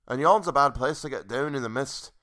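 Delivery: put-on lilting stab at a Scottish accent; rustic, not-quite-Scottish brogue; attempted Scottish accent. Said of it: "Trying to find my Scottish accent".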